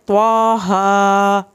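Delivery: "طواہا" with a heavy T and both vowels held; correct taha. Shown as a demonstration. Teacher correcting a common misreading, heavy elongated "طواہا". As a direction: draw out "طواہا" slowly and evenly. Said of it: "آپ نے اس کو پڑھنا ہے".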